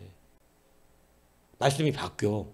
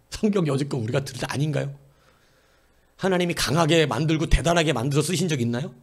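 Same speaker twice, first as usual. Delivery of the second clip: normal talking voice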